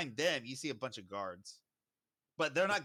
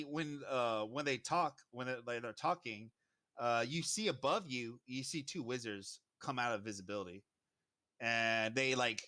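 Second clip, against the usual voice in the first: normal talking voice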